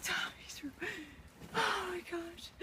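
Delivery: hushed whisper in disbelief; barely-breathed whisper. Real, not acted. Whispered at destroyed room.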